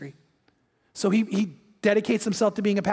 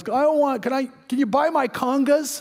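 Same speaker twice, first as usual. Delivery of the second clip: higher-pitched acted drunk; drunk man; 5 semitones higher than usual. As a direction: play a drunk stumbling over his pitch. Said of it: "this very drunk man".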